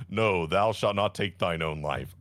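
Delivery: solemn, low voice from the sky; lightly voiced God. God stopping the mother from killing herself, lightly solemn. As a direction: boom like a god, but lightly. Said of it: "a big bright light in the sky says".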